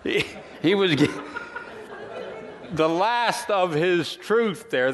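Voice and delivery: words broken up by real laughter; laughing while talking. Real, not acted. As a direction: chuckle through the words, still giggling at the punchline. Laughs at own joke.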